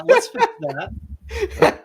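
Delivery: sheepish fix. Real, not acted. Embarrassed slow high.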